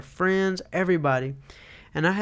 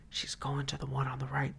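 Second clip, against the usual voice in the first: hushed, urgent warning; whisper; 9 dB quieter than usual. Retold or imitated. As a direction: whisper the bad news from hiding. Spotter warns while everyone hides.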